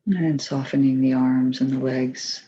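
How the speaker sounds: calm, even voice with long pauses; unhurried, settled meditation leader's delivery; guided meditation. Acted. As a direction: guide them gently through the body, leaving space between phrases.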